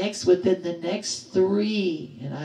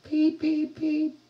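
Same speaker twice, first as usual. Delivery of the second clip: high, even imitated beeps; three high-pitched mock alarm beeps; warning beep; 9 semitones higher, slower than usual. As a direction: imitate a vehicle's warning beeper. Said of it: "I guess that was the warning beep".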